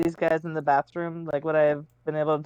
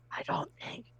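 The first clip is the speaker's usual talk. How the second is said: unvoiced, much softer whisper; 13 dB quieter than usual. Player warning the crew in a hushed whisper. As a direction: drop to a bare urgent whisper. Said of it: "I'm, like, s-, whisper shouting".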